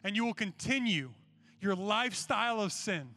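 forceful, emphatic sermon challenge; insistent preacher confronting the crowd; impassioned preaching. Real, not acted. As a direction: press the challenge home, punching the key words. Exhorts the students about commitment.

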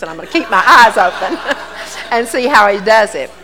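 laughing as you finish a funny story; amused punchline. Real, not acted. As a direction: land the joke with a real laugh. Punchline of retold testimony, laugh heard.

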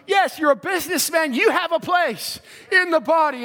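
ordinary talk

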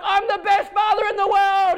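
high, long-carrying mock yell; acted yelling. Dad to the whole park, high mock yelling. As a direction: proclaim it as a proud dad would, pitched high. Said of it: "I'm yelling out to the whole park".

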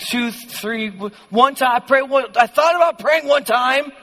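mock-confident claim crumbling into stammers; insist, then stutter your way down; flustered backpedaling. Preacher playing believer admitting to hardly praying, flustered backpedaling.

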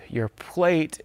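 ordinary delivery

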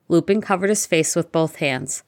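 plain reading voice

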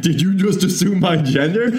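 mock quote. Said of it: "this week where you're like".

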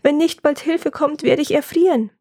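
wailing. Acted. Woodcutter hanging in the freezing tree, wailing. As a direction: plead with rising self-pity. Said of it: "jammerte der Mann".

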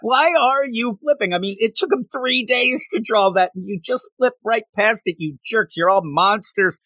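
high, indignant whine of complaint; mock outraged Deadpool. Deadpool whining at readers who flip past the art. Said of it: "And he's like".

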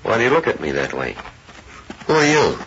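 sharp, high-pitched challenge; suspicious demand. Acted. Ranch hand questioning a boy who stares, suspicious.

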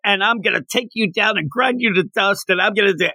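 high-pitched, hammed-up threat; Bakugo's mock rant. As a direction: imitate a hothead vowing revenge.

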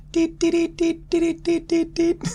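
high, steady beeping noises; mock radio beeps. Player jokes about what the radio really sounds like, high mock beeps. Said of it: "but they're actually saying".

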